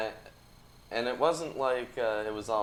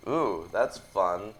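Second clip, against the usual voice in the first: ordinary talk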